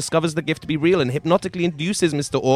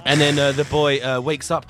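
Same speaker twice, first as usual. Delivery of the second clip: ordinary talk, nothing marked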